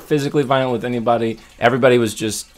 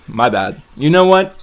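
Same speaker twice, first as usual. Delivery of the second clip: much higher, louder "you know what?"; mock realization; 6 semitones higher, 6 dB louder than usual. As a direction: sell the sudden change of heart. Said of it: "at that moment".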